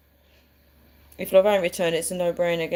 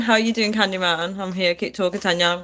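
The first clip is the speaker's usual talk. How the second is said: ordinary talk, nothing marked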